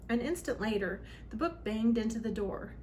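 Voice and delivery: ordinary delivery, nothing marked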